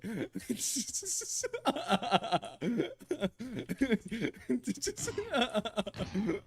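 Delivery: long, high, helpless laughter; laughing fit. Real, not acted. Streamer begs "don't kill me", then helpless laughter. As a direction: keep giggling, unable to stop.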